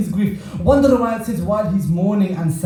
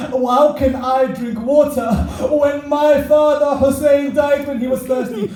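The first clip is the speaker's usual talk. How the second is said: slow, high, grief-stricken cry; anguished protest; 7 semitones higher, 4 dB louder than usual. Acted. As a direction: build the refusal into a mournful outcry. Grieving Imam refuses the water.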